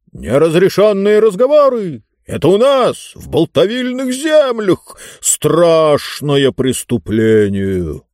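high-pitched outraged cry; deafening shouts. A narrator's reading. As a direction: cry out like a booming tyrant, slowing on the last phrase. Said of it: "оглушительные крики не прекращались".

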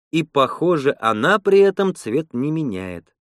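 ordinary delivery

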